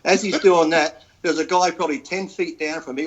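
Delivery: ordinary talk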